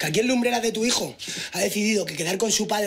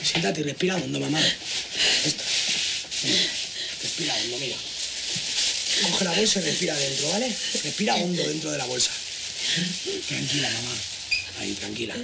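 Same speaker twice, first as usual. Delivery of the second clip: calming, reassuring; 2 semitones lower than usual. Acted. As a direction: talk her down from panic gently. Calming mother's panic attack. (tranquilizando con suavidad)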